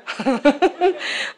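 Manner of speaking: amused chuckling burst; relaxed laughter at ordinary volume. Real, not acted. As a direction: laugh it off after the story. Laughing at end of anecdote.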